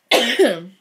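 loud, meaningful ahem; pointed throat clear. Acted.

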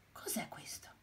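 hushed, curious whisper; soft puzzled whisper; whispered wonder. Acted. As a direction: whisper it like a secret discovery. Hen finds strange bulb.

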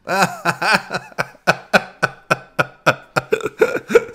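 long, high-pitched real laugh; laughing fit. Real, not acted. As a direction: burst out and keep on laughing. Rit de la scène. (long fou rire aigu)

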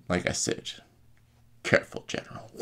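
hushed, near-whispered warning; quiet threat. Retold or imitated. Kylo Ren to the stabbed General, quiet threat. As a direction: deliver it softly, with pauses.

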